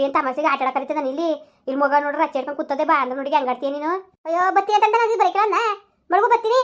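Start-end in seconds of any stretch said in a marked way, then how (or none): 4.3-6.6 exasperated. Acted. Exasperated wife on phone.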